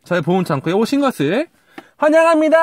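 cheerful show host greeting; bright high intro voice. Acted. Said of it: "host greeting viewers".

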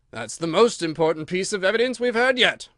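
loud royal pronouncement; pleased king. A narrator's reading. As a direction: announce it keenly as a satisfied king.

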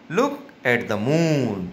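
slow read-aloud with "moon" held long; long vowel in moon. Shown as a demonstration. Teacher reading a sentence for children, moon drawn out slowly. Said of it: "फिर से सुनिए".

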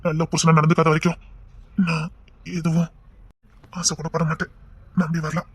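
tender murmured reassurance; hushed and tender. Acted. Intimate night talk to wife.

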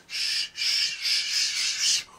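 unvoiced hushing sound; whispered shush. Host hushing his own Suffolk yells, whispered shush. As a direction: hush yourself sharply.

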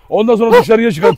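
usual speaking voice

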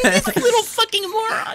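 high-pitched mimicking voice; mocking teammates. Player imitating teammates who ignore his call outs, mocking high voice. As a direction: squeak out the insult in mockery. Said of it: "and you guys are like".

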